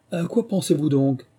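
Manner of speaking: high-pitched stern question; severe. A narrator's reading. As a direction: challenge an inattentive listener sternly. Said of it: "d'un air sévère".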